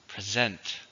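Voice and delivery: "Present" as pre-ZENT, weight on the second syllable; stress on second syllable. Shown as a demonstration. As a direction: model the verb "Present" carefully, with a z sound and stressed ending. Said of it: "stress on the second syllable".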